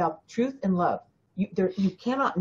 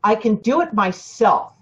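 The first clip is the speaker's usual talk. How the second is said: stubborn insistence; 3 semitones higher, 6 dB louder than usual. Retold or imitated. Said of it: "this part of you is going".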